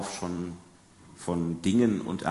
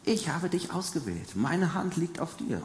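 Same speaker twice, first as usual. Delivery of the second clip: lightly raised, higher voice of God; 8 semitones higher than usual. Acted. Preacher voicing God calling Jeremiah, higher and lifted. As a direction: speak as God choosing him, lifted high. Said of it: "Die Stimme Gottes kommt und sagt".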